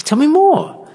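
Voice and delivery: sudden high amazed squeak. Retold or imitated. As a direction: pastor playing the stunned friend over coffee, amazed high voice. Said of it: "You say".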